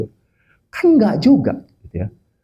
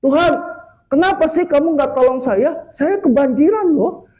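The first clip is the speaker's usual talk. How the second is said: high-pitched mock grumble; complaining; 8 semitones higher than usual. Dead grandmother to God who didn't help, high-pitched complaint. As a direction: play an aggrieved granny protesting. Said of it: "nenek itu komplain sama Tuhan".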